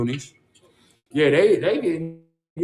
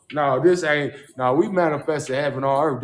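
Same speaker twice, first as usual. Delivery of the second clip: ordinary delivery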